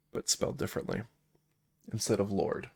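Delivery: normal talking voice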